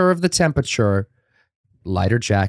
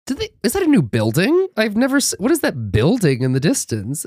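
confused surprise; 8 semitones higher than usual. Retold or imitated. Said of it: "I'm like".

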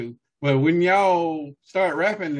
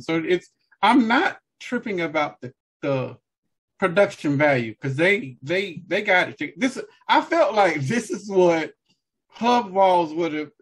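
ordinary delivery

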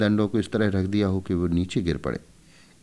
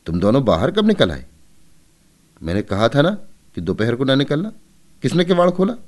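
louder, rising mother's scolding; 7 semitones higher, 5 dB louder, faster than usual. A narrator's reading. Mother catches both children outside at noon, scolding. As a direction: demand who opened the door.

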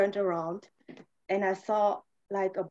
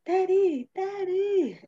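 high, louder wail imitating a crying child; retold tearful cry, pitched way up; imitated child crying; 11 semitones higher, 6 dB louder, slower than usual. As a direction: mimic a small child crying out for a parent. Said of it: "he was crying like".

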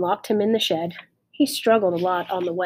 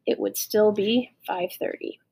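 plain reading voice

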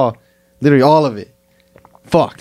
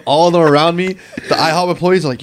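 normal talking voice

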